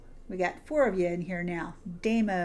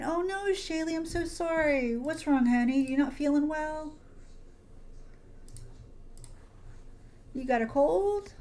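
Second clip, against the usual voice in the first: sympathetic coo; 7 semitones higher than usual. Real, not acted. Viewer says she is unwell.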